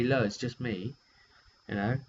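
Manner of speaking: ordinary delivery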